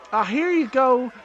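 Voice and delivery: high, loud barmaid voice; sweetly serve the drink. Acted. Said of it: "she's like".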